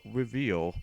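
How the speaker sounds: normal talking voice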